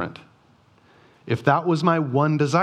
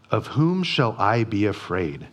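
low solemn reading of "afraid"; reverent reading; 6 semitones lower than usual. Acted. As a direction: read it low and measured like scripture. Reading the psalm verse.

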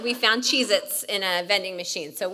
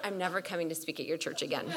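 fast soft laughing aside; a quick joking aside to the pastor friend; muttered joke; 4 semitones lower, 7 dB quieter, faster than usual. Retold or imitated. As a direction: throw it away under a laugh.

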